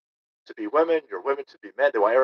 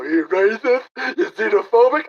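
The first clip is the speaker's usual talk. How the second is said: higher, louder mocking accusation; mocking accusers; 7 semitones higher, 6 dB louder than usual. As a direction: mimic an outraged accuser. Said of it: "then they go".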